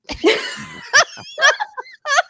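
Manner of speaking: high squealing laugh; high laugh burst. Real, not acted. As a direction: break into a high helpless laugh. Sister's baffled remark retold.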